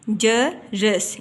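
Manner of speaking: जरस very slowly, ja, pause, ras; syllable by syllable. Shown as a demonstration. Teacher shows both zabar vowels, जरस slowly split in two. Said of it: "जीम के ऊपर भी ज़बर है".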